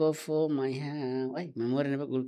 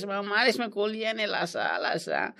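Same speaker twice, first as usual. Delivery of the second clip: ordinary talk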